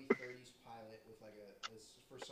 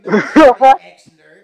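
laughing; 5 semitones higher, 36 dB louder, slower than usual. Real, not acted. Mocking mishearing, loud laughing.

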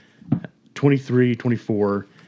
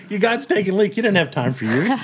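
mock taunting, barely holding back a laugh; 10 semitones higher, faster than usual. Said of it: "he's, like, saying".

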